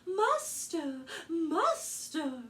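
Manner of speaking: high, loud ringing cry of alarm; chiming harp cry. Acted. The golden harp crying to its master giant, high alarmed cry. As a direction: call out like a magic harp raising the alarm. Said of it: "started chiming".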